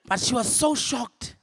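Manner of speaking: ordinary delivery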